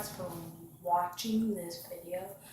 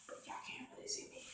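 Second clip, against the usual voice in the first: softer whispered aside; whispering; 16 dB quieter, faster than usual. Real, not acted. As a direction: hush it to the viewers. Quick aside to viewers.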